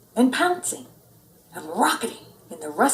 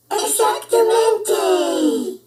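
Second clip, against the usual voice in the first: much higher, louder, slow howl; 12 semitones higher, 6 dB louder, much slower than usual. A narrator's reading. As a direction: howl it together in triumph. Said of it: "howled the doggies".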